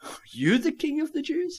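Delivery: high-pitched, incredulous rising question; sarcastic incredulity. Acted. Pilate scoffing at a beaten man called king, incredulous. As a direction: squeak it out in sarcastic disbelief. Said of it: "of sarcasm and incredulity".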